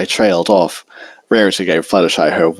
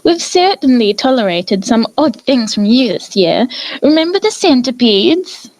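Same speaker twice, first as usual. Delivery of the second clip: higher, refined lady's teasing voice; Rarity defending Fluttershy and teasing Twilight, refined and higher; genteel Rarity. A narrator's reading.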